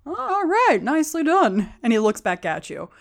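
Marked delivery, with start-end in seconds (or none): 0.1-1.7 high, swooping halfling admiration; be impressed, pitch leaping up; impressed approval. Acted. Halfling eyeing the severed head, impressed approval. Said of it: "gives a face of like".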